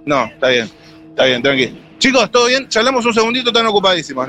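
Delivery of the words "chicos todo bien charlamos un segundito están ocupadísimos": high-pitched friendly street approach; hopeful, high-voiced pitch to strangers; calling to passers-by. Real, not acted. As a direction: flag down busy strangers in a higher voice.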